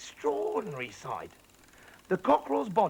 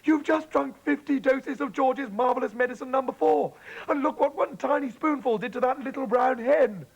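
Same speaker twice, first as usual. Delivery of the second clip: high, loud horrified warning; higher, louder alarmed plea; dismayed; 9 semitones higher, 6 dB louder than usual. Acted. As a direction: tell her what she's done. Daughter horrified at Grandma drinking.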